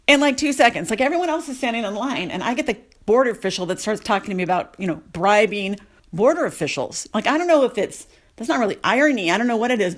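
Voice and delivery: usual speaking voice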